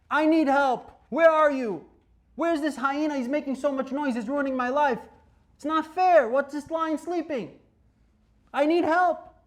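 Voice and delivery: a man begging God, at the end of his rope; desperate plea. Acted. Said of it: "Talk to HaKadosh Baruch Hu".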